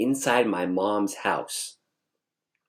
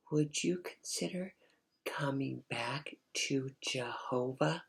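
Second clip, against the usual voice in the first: gently pleading mother; 3 semitones higher, 12 dB quieter than usual. Retold or imitated. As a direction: plead in a hushed mom voice. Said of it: "she was talking just like that".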